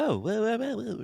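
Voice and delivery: high-pitched text-to-speech voice; robot voice. Retold or imitated. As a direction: imitate a TikTok text-to-speech voice. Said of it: "a robotic voice".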